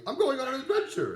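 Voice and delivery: high, excited meme voice; Hobbit meme quote. Retold or imitated. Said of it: "the whole meme ... is like".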